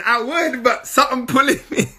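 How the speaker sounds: high, amused protest through giggles; laughing. Acted. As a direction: play the helpless excuse while cracking up. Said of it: "I'm laughing, but it's not a joke".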